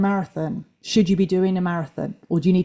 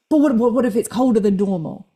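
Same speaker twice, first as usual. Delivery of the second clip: higher, stammered mock worry; acted inner worrier; 4 semitones higher than usual. Coach voicing their own anxious inner thoughts, mock fretful. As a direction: stumble into a pretend anxious what-if. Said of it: "me kind of talking to myself in my head".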